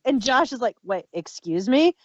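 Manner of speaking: ordinary delivery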